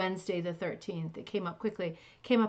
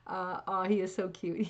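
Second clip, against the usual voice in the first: normal talking voice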